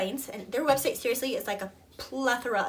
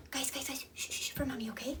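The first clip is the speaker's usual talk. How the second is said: breathy whisper to the toddlers; whispered hush; 10 dB quieter, faster than usual. Real, not acted. Mom whispers to her toddler twins to hush for her. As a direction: whisper them quiet without breaking the take.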